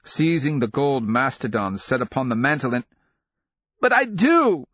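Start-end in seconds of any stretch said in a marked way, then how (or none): 3.8-4.7 put-on high, loud child's bawl. A narrator's reading. Son defying his mother after a slap, bawling. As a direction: bawl it out like an outraged little boy. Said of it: "he bawled".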